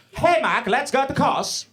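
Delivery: mock the Norwegian friends' singsong accent, up high. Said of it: "that's how they sound".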